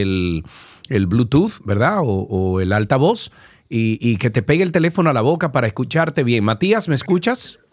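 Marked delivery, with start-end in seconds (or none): 4.6-7.6 calling to a faint caller. Real, not acted. Tú estás lejos del teléfono.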